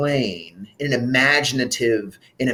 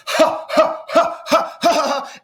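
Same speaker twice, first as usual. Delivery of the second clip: "ha, ha" pushed out from the chest; chest laugh; slower than usual. Shown as a demonstration. Warm-up leader shows voice in the chest. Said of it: "down in the chest".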